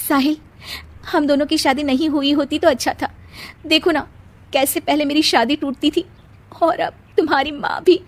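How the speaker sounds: unhappy bride blaming herself; self-blaming young wife. Acted.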